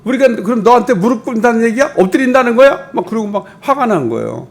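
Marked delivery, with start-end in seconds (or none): 0.0-2.9 높고 크게 따지는 형들의 분노; 동생에게 언성 높여 따져. Retold or imitated. (요셉에게 화가 난 형들이 언성을 높여 따짐)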